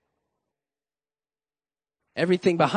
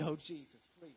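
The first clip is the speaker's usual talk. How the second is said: put-on hushed, pleading kid's prayer; whispered plea to Jesus for candy; desperate little prayer; 6 semitones lower, 21 dB quieter, faster than usual. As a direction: beg under your breath like a child. Kid praying for candy.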